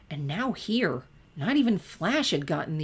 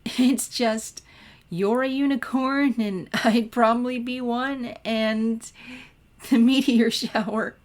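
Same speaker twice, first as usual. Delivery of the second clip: higher, louder, breaking into giggles; giggling; 7 semitones higher, 6 dB louder, slower than usual. A narrator's reading. Twilight to Sunset, giggling at the unicorn joke, higher and louder. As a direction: giggle through the words, barely holding it. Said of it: "another fit of giggles".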